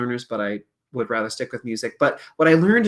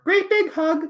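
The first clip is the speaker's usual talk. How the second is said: very high, loud puppet voice; puppet's encouraging voice; 5 dB louder, slower than usual. Acted. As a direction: squeal it sweetly high like a puppet. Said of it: "she'll go like".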